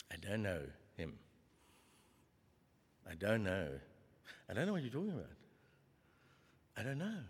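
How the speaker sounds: low, soft, insistent denials; hushed repeated denial. Acted. Peter denying Jesus three times, hushed and low.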